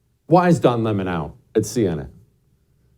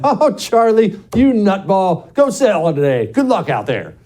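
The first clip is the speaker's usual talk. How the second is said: higher-pitched, chummy boss send-off that settles down; jovial boss; 8 semitones higher than usual. Acted. Boss waves his hungover top salesman through, jovial. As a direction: play an indulgent boss ribbing his star employee. Voicing an indulgent boss greeting Charlie.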